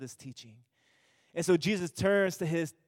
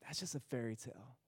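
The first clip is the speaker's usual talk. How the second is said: lightly voiced sad daughter; 3 semitones lower, 4 dB quieter than usual. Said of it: "She says".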